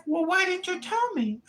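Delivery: high, whiny immigrant tenant voice; mock tenant voice. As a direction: whine like a fretful tenant. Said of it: "She's like".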